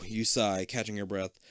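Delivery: ordinary delivery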